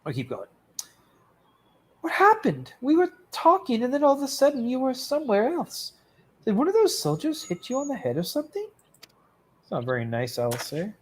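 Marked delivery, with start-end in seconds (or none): 2.0-8.7 high, fast, fretting friend; worried companion voice. Acted. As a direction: rattle off the worried questions up high.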